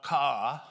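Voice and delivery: loud squawking crow caw. Acted. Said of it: "would shout".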